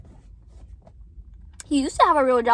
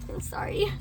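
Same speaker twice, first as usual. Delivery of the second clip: quick real whisper; whispered apology; 5 dB quieter than usual. Real, not acted. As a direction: whisper it, sheepish. Apologizing for swearing.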